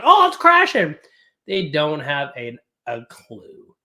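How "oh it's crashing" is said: high, loud mock panic. Streamer mocking YouTubers, hammed-up high panic. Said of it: "The next day they're like".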